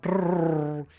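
lips buzzing a mock scooter rev; imitated scooter noise. Retelling Riddle going by on scooter, buzzing mock engine noise. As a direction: buzz it like Riddle riding past.

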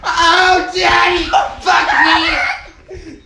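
a loud mock yell into the call. Real, not acted. Friend yelling loudly into the prank call to crash it.

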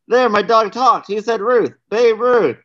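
high-pitched joke-telling mock shout; mild mock shout. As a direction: call it out high, half shouting. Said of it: "the man shouts at the bartender".

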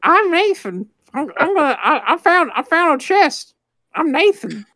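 squeaky high hero voice; silly high goofy voice; high cartoon voice. Mocking Uncharted hero.

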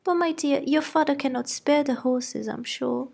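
high-pitched fretting mother; flustered wife defending her girls; fussy mother. A narrator's reading. Mrs Bennet voiced.

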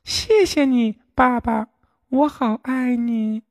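very high duckling voice, drowsy; a drowsy chick saying thank you; 小鸭子睡眼朦胧地说; sleepy duckling. A narrator's reading. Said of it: "睡眼朦胧地对狐狸说".

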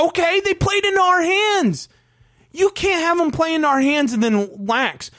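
high, exasperated insistence; real worked-up sports rant; heated arguing. Real, not acted. Still arguing the disputed point.

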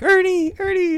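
very high, loud calling out; mock nurse yelling across the place; imitated yelling nurse. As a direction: holler a name in a shrieky falsetto. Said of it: "nurse, she starts yelling".

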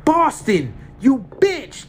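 high, loud furious insult. Acted. Terry curses Boston for giving up the light, furious. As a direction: spit the insult at her.

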